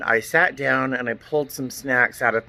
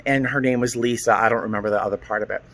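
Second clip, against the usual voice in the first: normal talking voice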